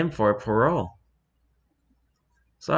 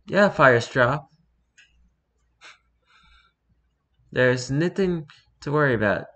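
a touch of cheering, higher voice; mild cheer; 4 semitones higher, 3 dB louder than usual. A narrator's reading. Graystripe reassuring Firestar there's no worry, mild cheer. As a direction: lift it into a light mock cheer. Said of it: "cheered Graystripe".

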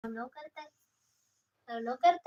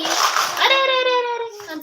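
much higher, louder startled cry; startled alarm; 9 semitones higher, 4 dB louder, slower than usual. Real, not acted. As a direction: yelp as if something tips over. Child startles her again.

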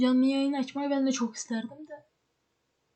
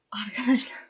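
whisper. Real, not acted. Aside to viewers, unvoiced.

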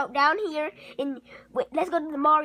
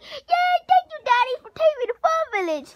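much higher, fast cheer; mock happy kid; 11 semitones higher than usual. Kid imitating Jeffy thanking his dad, high and fast. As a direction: squeal thanks like a delighted little kid.

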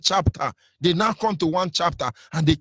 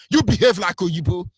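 ordinary talk

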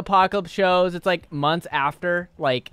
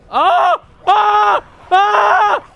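very high, loud screams; three full-volume shrieks in a row; repeated screams; 12 dB louder, much slower than usual. Real, not acted. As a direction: scream in panic at an attack. Screams as zombies attack in game.

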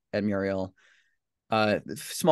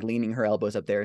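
usual speaking voice

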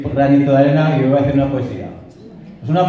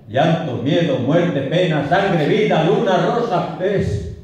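poem recitation; 3 semitones higher, slower than usual. Acted. The lover lists what their love is, mounting declamation. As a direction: list of words climbing steadily in pitch.

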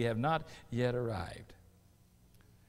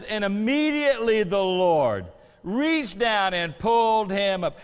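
slow, weighty reading of the verse; scripture reading; 6 semitones higher, 11 dB louder, slower than usual. Acted. Preacher reading the rescue verse slowly and solemnly. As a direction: lean on every word of the text.

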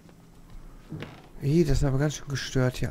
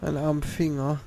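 usual speaking voice

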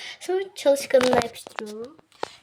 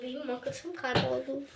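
ordinary delivery

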